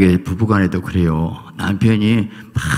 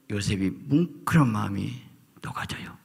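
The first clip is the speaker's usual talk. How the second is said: dramatic whisper; 7 dB quieter than usual. Acted. Preacher hushing the congregation as Joseph's heart melts, whispered. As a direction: drop to a hushed whisper. Preacher softens at emotional moment.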